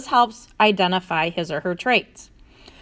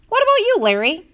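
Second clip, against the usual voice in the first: keen, high-pitched question; 11 semitones higher, 3 dB louder than usual. A narrator's reading. Character turns question on friend.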